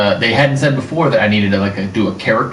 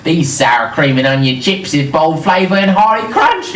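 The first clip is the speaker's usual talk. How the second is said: slow, higher, terrible Australian accent; worst Australian accent; 5 semitones higher, slower than usual. Shown as a demonstration. Actor reading a chip bag for friends, worst Australian accent. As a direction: badly fake an Australian accent, drawn out. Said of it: "Think of your worst Australian accent".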